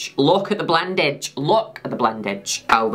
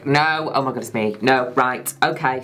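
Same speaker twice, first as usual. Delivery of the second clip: usual speaking voice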